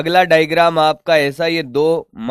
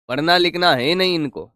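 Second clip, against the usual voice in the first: ordinary talk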